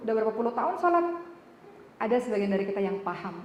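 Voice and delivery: ordinary delivery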